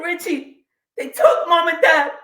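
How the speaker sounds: distraught sister. Retold or imitated. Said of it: "She said".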